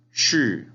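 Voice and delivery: "Shi" loud, pitched high then dropping; emphatic downward tone on "Shi"; falling tone. Shown as a demonstration. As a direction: drop the pitch steeply on "Shi". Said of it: "el último tono hacia abajo".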